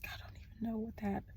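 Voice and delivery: quick, breathless whisper, moved; emotional whisper. Real, not acted. Reader caught off guard by her own feelings, whispering. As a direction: let it slip out under your breath, moved. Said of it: "I feel emotional about that".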